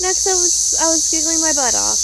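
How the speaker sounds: excited burst. Real, not acted. Recalling mood swings.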